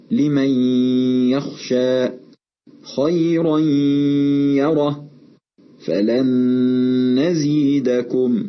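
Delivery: ن in "لمن يخشى خيرا يره فلن نزيدكم" melts into the next letter with a long nasal hum; slow recitation, holding a two-beat nasal hum where ن merges in "لمن يخشى خيرا يره فلن نزيدكم"; merging with ghunna. Shown as a demonstration. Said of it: "وحدثت الغنة".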